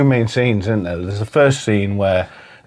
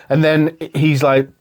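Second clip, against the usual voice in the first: ordinary talk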